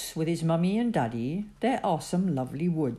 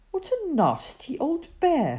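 indignant thought; 12 semitones higher than usual. A narrator's reading. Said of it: "thought Teddy".

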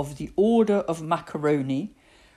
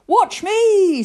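put-on high, loud boyish bravado; excited high-pitched cry; boastful boy; 12 dB louder than usual. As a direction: show off to mum, loudly. Victor boasts before his jump.

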